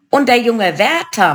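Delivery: a hint of a crone relishing her victim; high, lightly played witch; second witch. A narrator's reading. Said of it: "meinte die zweite".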